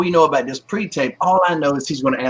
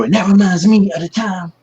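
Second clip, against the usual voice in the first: higher, louder heroic comic-book hero voice; superhero voice; 6 semitones higher, 5 dB louder than usual. Retold or imitated. Wrestler recalling his promo style, doing a superhero voice. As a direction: boom it out like a caped crusader. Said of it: "I don't know why I started talking like that".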